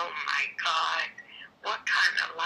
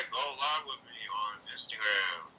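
deep dude voice; 10 semitones lower, 3 dB quieter than usual. Pretend. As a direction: play the laid-back guy. Same bro voice.

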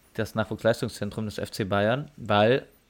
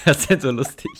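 laughing; 4 semitones higher, 9 dB louder than usual. Real, not acted. Laughing after choking fit.

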